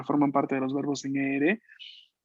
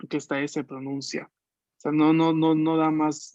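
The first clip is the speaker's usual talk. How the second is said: normal talking voice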